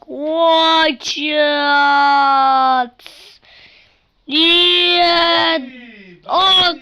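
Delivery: shocked yell. Real, not acted. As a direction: yell in disbelief.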